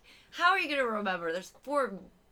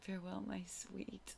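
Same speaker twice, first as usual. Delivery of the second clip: soft mock farewell; 13 dB quieter than usual. Acted farewell for the cupcake scene.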